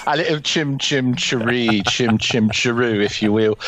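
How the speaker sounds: a light, hammed-up movie Cockney accent. A host joking about a film sex scene in a mock Cockney accent. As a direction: lilt it like a chimney sweep with a mock Cockney accent.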